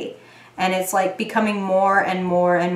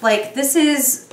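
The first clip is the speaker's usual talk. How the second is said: ordinary talk, nothing marked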